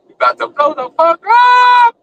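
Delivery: high, loud hype yell; hype shout. Real, not acted. Fan hyping the Steelers season to followers, loud shout. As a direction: blow the roof off for the team.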